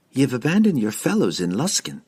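ordinary delivery, nothing marked

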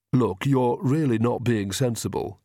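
mildly hissed reproach. A narrator's reading. Vlad reasons with Agnes, a touch of a hiss. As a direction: hiss it with restraint. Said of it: "he hissed".

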